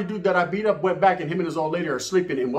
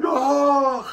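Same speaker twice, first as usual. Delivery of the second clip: high raised cry of pain; mock pained cry; 9 semitones higher, 4 dB louder than usual. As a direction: yelp like the maced guy. Victim cries out, maced.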